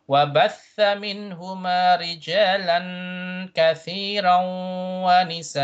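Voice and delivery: measured chanting with long-held vowels; level-toned Quranic chant; Quran recitation. Shown as a demonstration. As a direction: recite as a tajweed model, sustaining the nasal hums. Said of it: "for example, the first line".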